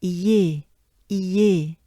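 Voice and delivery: iye said loud and held; loud drilled iye; accented E demo. Shown as a demonstration. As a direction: exaggerate the E sound in iye. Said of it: "and it goes like this".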